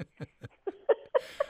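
laugh. Real, not acted. Laughing after her song.

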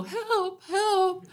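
high, small cry for help; a weak high yelp for help; timid help. Shown as a demonstration. Stranded woman shows the call that won't work, high. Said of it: "I couldn't go".

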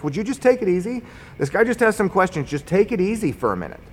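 high-pitched imitation of an exasperated peacemaker; retold exasperated plea. Bystander steps between two men, retold exasperated plea.

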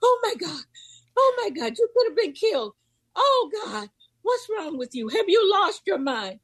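high, alarmed grandmother voice; frantic grandmother. Retold or imitated. Imitating her grandmother scolding her after the bus, high and panicked. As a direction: plead like a terrified grandma. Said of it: "she was like".

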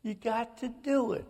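high, pleading cry to her dying father; retold screaming plea. Sister screaming at her dying dad, high desperate plea. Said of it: "I hear Carol screaming at my father".

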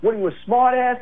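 high-pitched retold angry challenge; cursing cop. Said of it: "starts cursing at me".

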